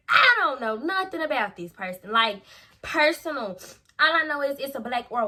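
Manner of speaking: loud, choked, breaking voice; near tears. Real, not acted. Telling viewers she knew nothing about the relative, tearful. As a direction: push through the words close to crying. Distressed recounting interrogation.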